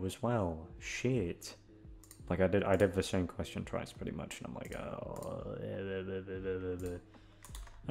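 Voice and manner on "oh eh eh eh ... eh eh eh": soft breathy eh, eh, eh sputter; mock flustered stammer. Vlogger mocking herself for repeating a question, flustered eh-ing. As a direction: sputter like caught out.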